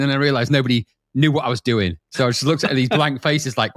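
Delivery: ordinary talk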